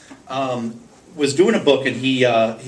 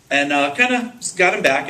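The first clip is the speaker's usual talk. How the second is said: ordinary talk, nothing marked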